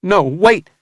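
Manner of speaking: high, loud desperate cry; cried out. A narrator's reading. Samuel crying out as Vincent is dragged off. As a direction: cry out to stop them. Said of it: "Samuel cried out".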